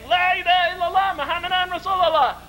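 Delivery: very high, fervent rallying cry; with feeling. Retold or imitated. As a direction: lead the crowd in an impassioned creed. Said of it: "with feeling".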